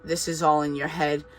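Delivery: ordinary talk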